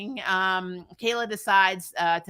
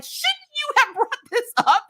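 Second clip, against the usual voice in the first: high, slow, laughing disbelief; mock exasperation at Mel, high and laughing; 9 semitones higher, 4 dB louder, slower than usual. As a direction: laugh through an incredulous complaint.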